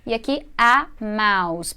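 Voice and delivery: normal talking voice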